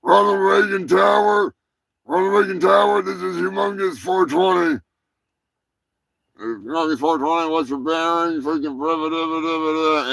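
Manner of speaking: loud, high radio call to the tower; pretend pilot radio call. Host pretending to radio the tower, shouted call. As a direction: hail the tower like a goofy pilot. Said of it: "Imagine you're like".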